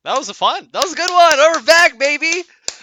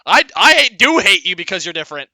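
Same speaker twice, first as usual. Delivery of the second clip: mock knowing bigot; 4 semitones higher, 12 dB louder, faster than usual.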